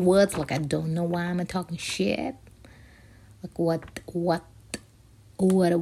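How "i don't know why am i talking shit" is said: ordinary delivery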